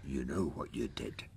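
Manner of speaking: low, soft menace; quiet threat to his son; menacing low father. Acted. [name] reads the menacing father.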